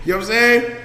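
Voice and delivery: ordinary talk, nothing marked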